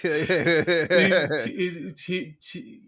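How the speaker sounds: laughing through a mock stammer; laughing imitation. Friend laughing while quoting the line. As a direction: giggle out the repeated word.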